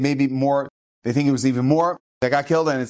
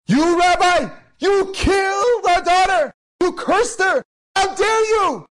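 much higher, louder, slow enraged accusation; furious shout; 4 dB louder, slower than usual. Retold or imitated. Grieving family screaming at the rabbi, furious shout. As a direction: scream the blame at him.

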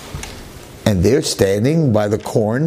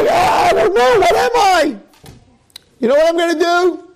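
much higher, louder, near-crying panic; distraught outburst; 3 dB louder than usual. Retold or imitated. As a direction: cry out lost and overwhelmed. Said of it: "He's like".

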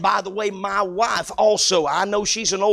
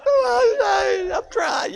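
tearful protest; 10 semitones higher, slower than usual. Retold or imitated. Father to wife at dorm, tearful high protest. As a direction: wail it through tears.

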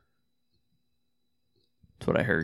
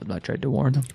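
monotone imitation. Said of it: "a fucking monotone, dead serious".

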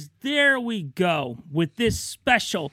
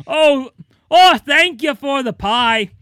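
high, slow gushing thanks; pleased and gushing; delighted guard; 6 semitones higher, 6 dB louder, slower than usual. Acted.

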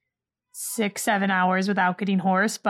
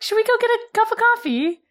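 higher mock British accent; fake British accent; 12 semitones higher than usual. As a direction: ask it like a put-on Brit. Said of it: "I'd be like".

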